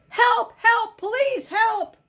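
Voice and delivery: high, loud cries for help; a frantic storybook shout for help; calling for help. A narrator's reading. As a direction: yell for help across the street. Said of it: "someone called out".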